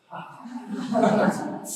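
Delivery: short real laugh on ha ha; demo laugh. Shown as a demonstration. Teacher laughing to lead the meditation group, light laugh. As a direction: laugh it out for the class. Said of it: "please try to laugh".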